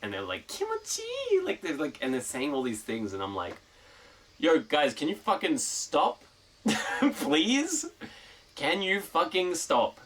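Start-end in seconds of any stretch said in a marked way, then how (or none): 0.5-1.0 squealed high mock moan. 4.4-10.0 higher, louder pleading with a laugh; exasperated yell.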